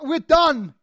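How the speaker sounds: sudden loud snap of a worn-out teacher, up high; fed-up teacher. Retold or imitated. Teacher hounded by a kid, loud final snap. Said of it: "crazy teacher who's just been hounded".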